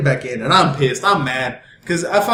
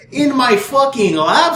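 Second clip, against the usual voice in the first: higher, slow, incredulous comeback; exasperated retort; 5 semitones higher, much slower than usual. Retold or imitated. Telling the cop where the pizza is, exasperated. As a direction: stretch it out in mock exasperation.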